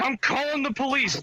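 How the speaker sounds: put-on high protest; imitated upset husband. Husband threatening, retold quarrel.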